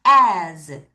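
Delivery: "As" drawn out to a buzzing z; voiced Z ending. Shown as a demonstration. Teacher modelling the word for students, "As" ending in z. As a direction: end "As" on z, never a hissed s. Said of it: "S 아니에요".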